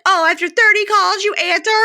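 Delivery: high, loud mock outrage; indignant girlfriend. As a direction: play an exasperated girlfriend catching him out. Retold angry phone confrontation.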